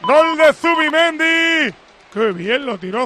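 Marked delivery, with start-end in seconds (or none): 0.0-1.8 goal call. Real, not acted. Goal scored, commentator calls.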